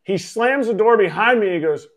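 normal talking voice

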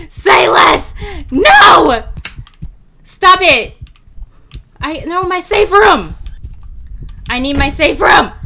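high, loud frantic yelling; terrified pleading shouts; panicked screaming. Real, not acted. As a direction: scream at the game in real fear. Panicking in horror game.

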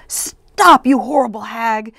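high, loud outburst easing off; attempted shout. A narrator's reading. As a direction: yell it out to stop a witch. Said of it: "I tried to shout".

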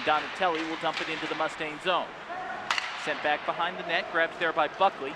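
play-by-play. Acted. Live play-by-play.

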